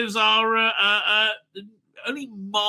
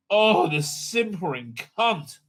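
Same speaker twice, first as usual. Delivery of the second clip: usual speaking voice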